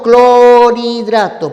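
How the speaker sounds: "clorhidrato" alargado y fuerte, clooo-ri-drato; pronunciación enfatizada. Shown as a demonstration. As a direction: estira las sílabas de "clorhidrato", con la r unida a la i. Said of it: "Sigues pronunciando". ("clorhidrato" en voz alta, clo-riii-drato)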